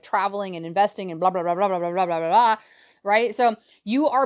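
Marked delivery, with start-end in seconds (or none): none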